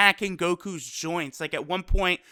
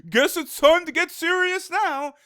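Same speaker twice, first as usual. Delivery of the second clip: high-pitched put-on cartoon hero voice; Goku; 8 semitones higher than usual. Imitating a fighter about to get serious, high put-on voice. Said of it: "Goku is like".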